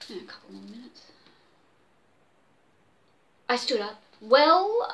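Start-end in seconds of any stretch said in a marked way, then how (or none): 0.1-1.0 quiet aside away from the mic. Real, not acted. 4.2-4.9 drawn-out well, louder and higher; mock yawn. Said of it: "I yawned in mock fatigue".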